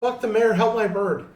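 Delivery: loud, high panicked yell; yelling in a panic for his pet; frantic shout. Acted. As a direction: shout over him to save the bird. Player panicking over hurt bird.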